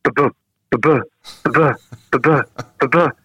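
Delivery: rhythmic ba-ba of a ball hitting a sock; ball thud imitation. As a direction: tap out the thud rhythm with your voice. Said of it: "so you hear".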